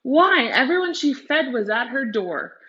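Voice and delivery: ordinary delivery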